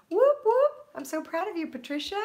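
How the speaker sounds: high, loud whoop whoop; excited cheer. Real, not acted. Cheering a viewer who finished the dishes. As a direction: cheer like at a game. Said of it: "cheering a viewer".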